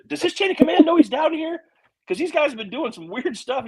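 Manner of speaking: high-pitched, incredulous question; alarmed retold questioning; retold incredulity. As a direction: pitch it way up in mock disbelief. Retold incredulous question about mechanic.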